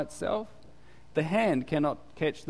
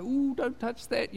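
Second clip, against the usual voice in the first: mock warning; 9 semitones higher than usual. Mock warning about delicate things.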